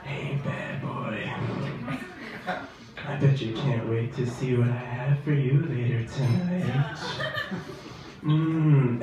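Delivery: low, husky seductive purr; sexy radio woman voice. Retold or imitated. Comic doing a sultry radio commercial voice. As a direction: tease him like a late-night radio ad. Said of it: "this woman's voice like".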